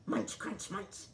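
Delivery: chomp out the words; munching sounds. A narrator's reading. Eating sound effects.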